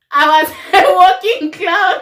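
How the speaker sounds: high, loud words broken by real laughter; giggling self-mockery; laughing at herself. Real, not acted. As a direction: crack up mid-sentence about yourself.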